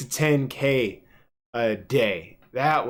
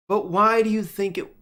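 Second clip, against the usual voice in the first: ordinary talk, nothing marked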